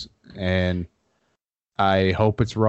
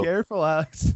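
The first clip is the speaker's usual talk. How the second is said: teasing warning; 9 semitones higher than usual. Real, not acted.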